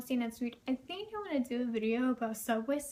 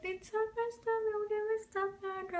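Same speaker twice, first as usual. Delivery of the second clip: put-on high whiny sobbing kid voice; imitating her younger self crying; mock crying kid; 10 semitones higher than usual. Telling the bus driver about her stuff, in a whiny high kid voice.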